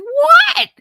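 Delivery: sudden high loud "What?"; startled shout. Retold or imitated. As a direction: jolt awake and yell it. Startled mom woken by son.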